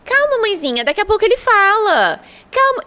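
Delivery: high, sing-song reassuring doctor; soothing pediatrician. Retold or imitated. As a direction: coo at the mother to calm down. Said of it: "a gente escuta isso muito de pediatra". (pediatra tranquilizando a mãe, voz aguda e melosa)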